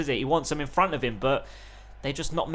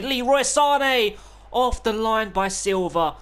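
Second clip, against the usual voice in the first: higher, louder, stretched-out call; excited call; 6 semitones higher, 5 dB louder, slower than usual. Real, not acted. Gamer commentating a goal-line clearance, excited shout.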